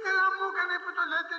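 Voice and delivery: high, fast, smug voice of a fool; foolish bragging. Retold or imitated. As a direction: rattle it off pleased with yourself. The foolish man brags. (καυχησιάρικος, γρήγορος τόνος αφελή)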